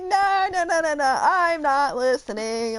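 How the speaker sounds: high, loud sing-song no's like a middle schooler; childish refusal. Retold or imitated. Teacher blocking out the student's definition, high childish no's. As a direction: cover your ears like a kid. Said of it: "like freaking middle school".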